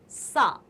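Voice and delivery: "सा" with a plain hissed s; clear dental sa. Shown as a demonstration. Teacher showing students the first sibilant, "सा" with plain s. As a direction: say "सा" slowly with a clean s. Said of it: "उच्चारण आप शुद्ध कीजिएगा".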